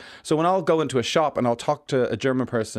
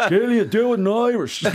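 laughing heckle; 9 semitones higher, 4 dB louder than usual. Retold or imitated. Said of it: "They're gonna be sitting there".

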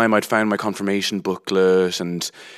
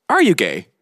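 sharp, louder accusing question; stern teacher question; 5 semitones higher, 6 dB louder than usual. Retold or imitated. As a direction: snap it at a child. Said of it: "she just turned to me and went".

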